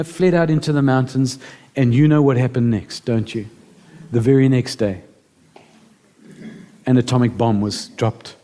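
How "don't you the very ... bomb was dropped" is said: usual speaking voice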